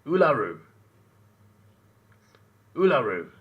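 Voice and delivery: Uluru as "oo-la-roo", loud and falling; practised Uluru. Shown as a demonstration. Streamer keeps trying for chat, Uluru said loudly. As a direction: punch out Uluru with a falling end. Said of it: "Did I get it?".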